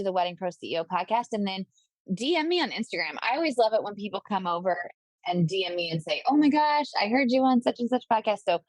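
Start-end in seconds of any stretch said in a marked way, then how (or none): 6.3-8.4 higher, louder acted gush; excited fan message. Podcaster imitates a listener messaging after an episode, excited. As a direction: pipe up like a delighted listener.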